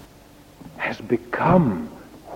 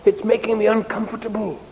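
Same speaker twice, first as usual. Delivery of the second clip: complaining fire; 10 semitones higher than usual. Acted. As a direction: moan in a high voice like a fire being puffed at. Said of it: "it was complaining and saying".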